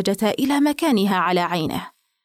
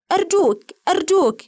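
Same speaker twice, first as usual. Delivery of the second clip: high-pitched begging, each word falling; repeated plea; 9 semitones higher than usual. A narrator's reading. Paxton begging Gregory over the monocle, high repeated plea. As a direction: plead insistently, pitched well up. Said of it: "وراح باكستون يكرر".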